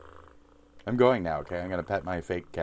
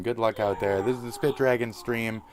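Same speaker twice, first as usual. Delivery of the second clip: ordinary talk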